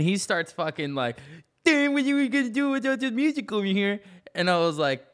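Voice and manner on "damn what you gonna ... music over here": high, taunting put-on guard voice; sneering impression of a pushy security guard; mocking security guard. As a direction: mimic the cocky guard, pitched way up.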